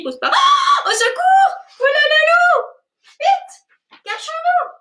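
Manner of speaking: high panicked cry. Acted. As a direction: shriek in playful fright. Thumbs flee the wolf.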